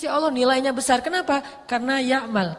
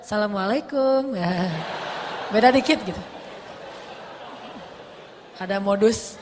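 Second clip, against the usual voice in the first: flirty salam; 3 semitones lower than usual.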